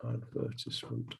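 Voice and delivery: "Advertisement" very softly, as ad-VER-tis-ment; short "tis" in "Advertisement", stress on VER; British pronunciation. Shown as a demonstration. Teacher repeating own form to self, soft ad-VER-tis-ment. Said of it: "No, I say advertisement".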